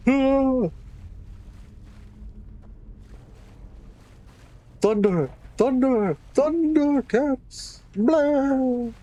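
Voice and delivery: high, drawn-out heroic battle cry; Thundercats battle cry. Pretend. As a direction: belt it out like a cartoon hero.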